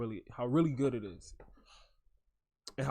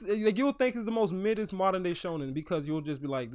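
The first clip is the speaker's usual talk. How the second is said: normal talking voice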